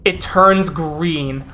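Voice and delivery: ordinary talk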